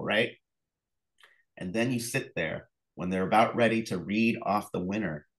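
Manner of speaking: ordinary talk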